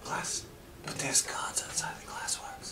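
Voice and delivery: nervous hushed voice; worried whisper. Acted. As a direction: whisper it fearfully. NPC whispering worried reply.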